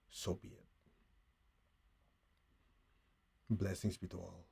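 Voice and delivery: hushed, unhurried blessing; guided meditation. Real, not acted. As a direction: gently guide them into stillness.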